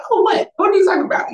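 high, loud, incredulous questions; mock bewilderment. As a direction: replay being totally caught off guard, pitch way up. Acts own bewildered reaction to proposal.

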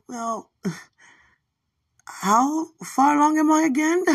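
high, loud, giggling through the question; laughing question. Retold or imitated. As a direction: laugh while asking. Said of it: "I was like".

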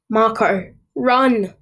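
urgent warning. A narrator's reading. Urging boyfriend to flee clown.

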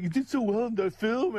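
retold high-pitched fan praise; self-mocking star-struck compliment; gushing. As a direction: gush at him in a high voice. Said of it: "you didn't say it like that".

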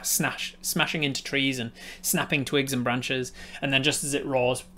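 ordinary delivery

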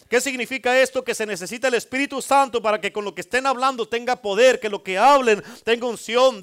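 loud, rising preacher's cry, each line hammered; fiery preaching. Acted. Preacher rousing the congregation to sing, loud and fervent. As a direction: preach it to the back row with full force. Second sermon climax.

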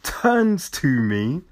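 ordinary delivery

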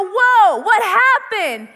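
loud, drawn-out astonished whoa; amazed friends. Retold or imitated. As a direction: gasp it out, slowly and loud. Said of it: "your friends should go".

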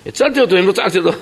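high, animated protest; indignant self-defence. Retold or imitated. Rescuer defending his shout to save someone, indignant and high.

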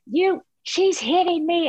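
put-on high whiny tattling child; tattling kid. Mom imitating her other daughter tattling, a high whine. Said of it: "my other one's like".

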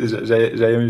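laughing loudly. Real, not acted. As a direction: laugh while you speak. Friends joking, laughing.

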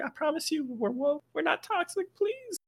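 hammed-up soft, squeaky plea; mock pleading. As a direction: plead meekly up in a high register. Acts out pleading with listeners.

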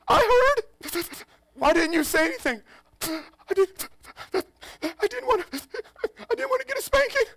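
put-on very high, sobbing little-boy voice; crying child. Small son crying to his father, very high. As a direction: whimper like a scared kid. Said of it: "Crying, crying".

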